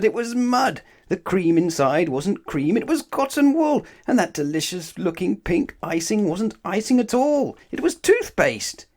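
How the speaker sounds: high, loud disgusted reveal; horrified reveal. A narrator's reading. Narrator revealing the fake cake to children, high and loud. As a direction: build the horror of each discovery.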